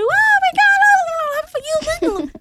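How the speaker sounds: very high squeal of joy; excited screaming. Retold or imitated. Retelling everyone hearing the wedding news, screaming happily. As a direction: imitate friends shrieking congratulations. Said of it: "Everybody was shouting, screaming".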